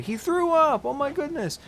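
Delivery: high-pitched retold exclamation; mock alarm. Third grader announcing classmate threw up, mock alarm. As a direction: exclaim it like a shocked kid. Repeats his childhood exclamation.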